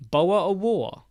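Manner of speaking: "Boa or war" with dropped t and r; glottal accent. Shown as a demonstration. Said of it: "which accent would say it like that".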